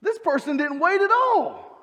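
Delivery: hammed-up irritated patient protesting the queue; indignant outburst. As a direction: play up the waiting-room indignation. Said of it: "I'm like".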